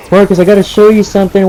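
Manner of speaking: put-on eager kid. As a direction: imitate a kid rushing in with news. Voices the kid running inside.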